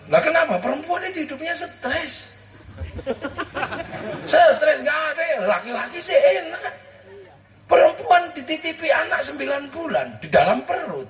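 high, laughing comic rant; excited rant. Acted. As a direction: push the joke up high and lively. Comic rant about women's burden. (berapi-api sambil tertawa)

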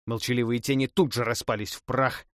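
ordinary delivery

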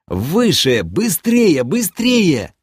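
high shouted orders, climbing in pitch; urgent yelling. A narrator's reading. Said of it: "завопил я". (вопить команды, всё выше и настойчивее)

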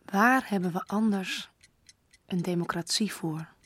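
ordinary talk

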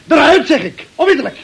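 sharp, high panicked order to get out; angry order. Acted. Ordering Michael out of the house in a high, frantic yell. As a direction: shove him out with your voice, high and urgent.

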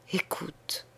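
put-on breathy hushed whisper of a dying girl. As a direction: breathe the word out with no voice. (murmuré dans un souffle)

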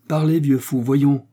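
loud, high, impatient demand; impatient order. A narrator's reading. Captain demanding answers from the innkeeper, impatient. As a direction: press the old fool to speak up.

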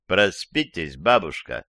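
ordinary delivery

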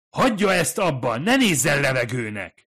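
loud, high furious yelling; you won't be ignored, yell it; angry shout. Acted. Furious demand at silent woman.

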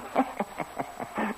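soft breathy chuckle under the breath; sly chuckle. Acted. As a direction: laugh quietly, knowingly. Sly laugh about the king. (zacht, sluw gegrinnik)

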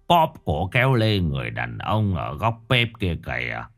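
put-on low, slow, softened old master's voice; grave old spirit master. A narrator's reading. Old master reveals what he saw to the family, grave and slow. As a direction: pronounce the disaster gravely, low and drawn out.

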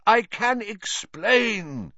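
high, drawn-out desperate plea; shouting. A narrator's reading. Lupin pleading with Hermione, shouting. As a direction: call it out slowly, insisting. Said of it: "Lupin shouted".

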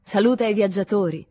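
a lightly voiced cheerful welcome; cheerful greeting. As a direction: greet the travellers gaily. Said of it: "disse allegramente".

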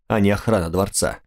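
ordinary delivery, nothing marked

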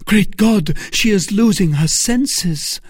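loud, high panic; alarmed cry. A narrator's reading. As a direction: exclaim in horror for a loved one. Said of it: "cried D'Artagnan".

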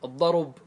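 الضرب said "ad-darub", u after the r; damma moved back. Shown as a demonstration. Teacher showing vowel transfer, الضرب as "ad-darub". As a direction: move the u onto the r in الضرب, ending on b. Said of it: "انقلوا الضمة إلى الراء".

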